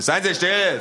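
loud, high, forceful order; emphatic. Acted. Official silencing the pair, loud and emphatic. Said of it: "sagt der Mann mit Nachdruck".

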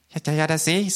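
high, impatient character voice; agitated little K. A narrator's reading. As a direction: brush it off, high up. (hohe, ungeduldige Figurenstimme)

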